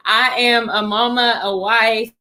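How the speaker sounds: normal talking voice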